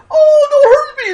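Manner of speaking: old lady squealing in fake terror, hammed-up; hammed-up frightened granny shriek; mock scream. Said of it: "She scream".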